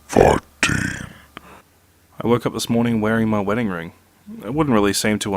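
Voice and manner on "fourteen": drawn-out, growling horror announcer; spooky countdown voice. Acted. Narrator announces the next creepy story, spooky countdown voice. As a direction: boom the number out long and eerie.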